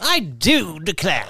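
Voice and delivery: slow mock British accent; posh British accent. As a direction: drawl it like a British toff. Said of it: "So they're British".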